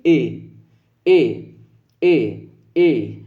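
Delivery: ए repeated slowly with the palate; e sound demo. Shown as a demonstration. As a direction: sound ए again and again using the palate. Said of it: "उच्चारित करके देखिए". (ए को तालु और कंठ से बार-बार बोलकर)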